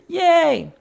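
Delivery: high, loud cheer; cheering yay. A narrator's reading. Guests clapping for George's trick, cheering. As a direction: cheer it like a happy crowd.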